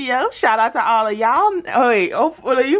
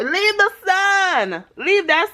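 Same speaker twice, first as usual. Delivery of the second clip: higher and very slow, stretching it; stretched-out playful request; drawn-out plea; 8 semitones higher, slower than usual. Real, not acted. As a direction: coax the viewers lazily. Asking viewers for emoji.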